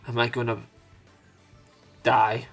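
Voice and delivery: ordinary delivery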